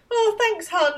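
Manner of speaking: put-on high-pitched girlish sweet-talk; mock feminine wiles. Schoolgirl sweet-talking Simon for a favour, mock coy and high. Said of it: "using her feminine wiles".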